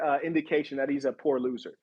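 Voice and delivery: usual speaking voice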